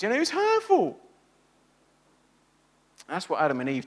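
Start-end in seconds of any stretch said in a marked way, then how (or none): 0.2-1.0 put-on much higher, blaming child's voice; childish blame. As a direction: point the finger like a little kid. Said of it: "like our children say sometimes".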